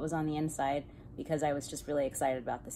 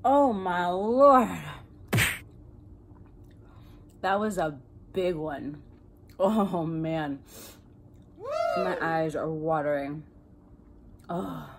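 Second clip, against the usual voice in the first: a loud, slow "oh my Lord" as the heat hits; wasabi burn; 8 dB louder, slower than usual. Real, not acted. Eating too much wasabi, loud and slow as the burn hits. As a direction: gasp it out loud while your eyes water.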